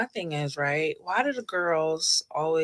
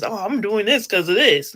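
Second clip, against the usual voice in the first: louder, higher mock excuse; 3 semitones higher, 6 dB louder than usual. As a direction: mimic their excuse in a raised voice. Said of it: "You don't gotta make these excuses".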